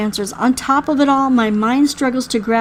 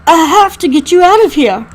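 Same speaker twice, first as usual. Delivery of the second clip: louder, higher urgent voice; urgent insistence; 4 semitones higher, 6 dB louder than usual. Acted. Rescuer to injured survivor, urgent insistence.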